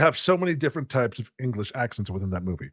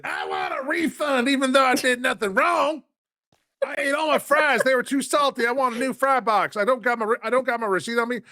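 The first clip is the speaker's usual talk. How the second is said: Karen impression; 10 semitones higher than usual. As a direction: demand a refund like a petty Karen. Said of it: "impersonate a Karen".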